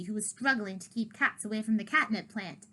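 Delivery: plain reading voice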